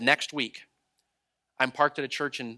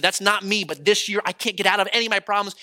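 frustrated; 6 semitones higher, 5 dB louder than usual. Retold or imitated. Retold man's frustrated confession.

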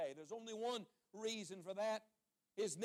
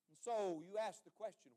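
normal talking voice

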